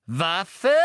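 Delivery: loud, rising, astonished echo; excited. A narrator's reading. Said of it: "vor Aufregung aus den Höhlen".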